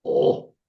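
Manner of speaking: "o" breathed out with only air, no voice; aspirated o. Shown as a demonstration. Teacher showing the aspirated letter as "o". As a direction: let "o" out as a breathy puff. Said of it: "sería o".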